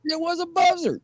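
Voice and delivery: high-pitched comic reveal; incredulous punchline. Real, not acted. Storyteller reveals what Sasquatch really was, high and incredulous. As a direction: land the punchline in a high squeal.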